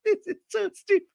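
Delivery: very high squeaky real laughing; helpless laughter. Real, not acted. Host finds the drama so stupid, real helpless laughter. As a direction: try to speak through a laughing fit.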